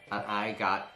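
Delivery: slow, drawn-out disgust breaking into a cry; comic wail of disappointment; exasperated. Real, not acted. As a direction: drag out ABSOLUTELY NOTHING in despair. Others got prizes, player got none.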